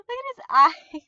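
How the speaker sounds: loud, high, laughing look at his eyes; excited squeal. Retold or imitated.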